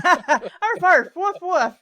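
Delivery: loud playful barking; laughing dog barks. Pretend.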